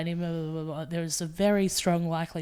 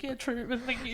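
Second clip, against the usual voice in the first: laughing thanks; 6 semitones higher, 4 dB quieter than usual. Retold or imitated. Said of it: "And I'd be like".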